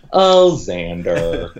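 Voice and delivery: very drawn-out mock sigh of a name; exaggerated, slow "oh, you" tone; mock indulgence. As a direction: stretch it out in pretend forgiveness. Mock indulgent forgiveness of Xander.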